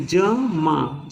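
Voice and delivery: जमा stretched very slowly, ज then मा; teacher breaking जमा into syllables; slow syllable demo. Shown as a demonstration. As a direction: split जमा into its sounds, slowly. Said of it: "जब माँ बोलेंगे".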